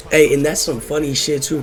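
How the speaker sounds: usual speaking voice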